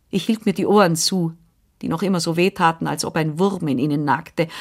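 usual speaking voice

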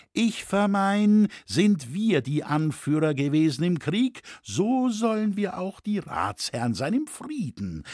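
rally your guild brothers with rhetorical questions; speechifying tailor. Acted. (eindringlicher Redner mit höherer Stimme)

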